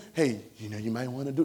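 low, soft, noncommittal mock advice; hesitant mock advisor, hushed and deep; tentative suggestion. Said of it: "Holy Spirit don't talk like that".

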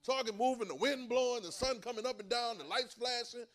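loud, high preaching build; preaching at full force. Acted. Preacher pounding the congregation with rising force. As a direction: drive it home from the pulpit.

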